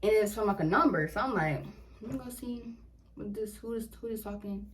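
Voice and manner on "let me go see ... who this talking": much softer, half under the breath; muttering to herself. Retold or imitated. Reading a text from an unknown number, muttered softly. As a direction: mutter as if checking the phone.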